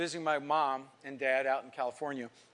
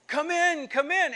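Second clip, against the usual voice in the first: much higher, eager invitation; excited welcome; 11 semitones higher, 4 dB louder, slower than usual. Retold or imitated. Old neighbor at the door, excitedly welcoming. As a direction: usher him in with high-pitched delight.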